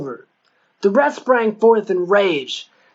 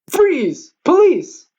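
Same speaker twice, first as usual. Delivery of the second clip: police shout; 8 semitones higher, much slower than usual. A narrator's reading. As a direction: bellow it like a cop springing an ambush.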